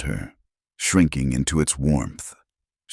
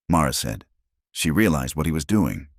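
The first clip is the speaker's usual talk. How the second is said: ordinary delivery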